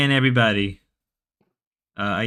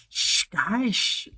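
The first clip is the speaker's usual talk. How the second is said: mock Ruby shushing; 8 semitones higher, 5 dB quieter, slower than usual. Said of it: "How did Grace do the Ruby voice?".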